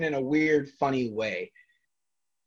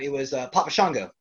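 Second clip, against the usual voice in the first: ordinary talk, nothing marked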